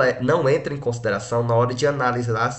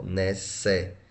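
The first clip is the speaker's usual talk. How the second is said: syllable by syllable; 4 semitones lower, 5 dB quieter than usual. Shown as a demonstration. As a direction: sound out "necessário" one separate syllable at a time. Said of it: "Assim, ó".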